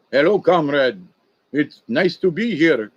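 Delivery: lightly put-on Russian accent, greeting a comrade; a hint of a Russian stranger saying hello. Telling of swamp strangers, in a mild Russian accent. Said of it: "Russian accents".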